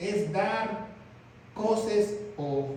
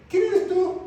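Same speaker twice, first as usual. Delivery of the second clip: high amazed question; 10 semitones higher, 5 dB louder, slower than usual. Shown as a demonstration. Preacher shows how you will greet the true God, high and amazed. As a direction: voice jumps up high and louder, stunned. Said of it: "no le vas a decir".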